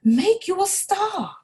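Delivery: a small eager mouse making a promise; high-voiced Timothy, eager and bright; excited mouse voice. A narrator's reading.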